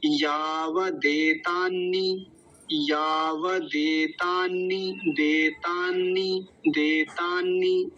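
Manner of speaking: "यावदेतान्नी" drawn out slowly, double n held; joined syllable by syllable. Shown as a demonstration. Teacher shows how the Sanskrit word joins up, slow careful demonstration. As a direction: sound out "यावदेतान्नी" slowly, long vowels stretched. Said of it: "बोलेंगे शुरू से कैसे जुड़ जाएगा".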